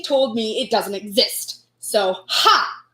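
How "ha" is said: ordinary talk, nothing marked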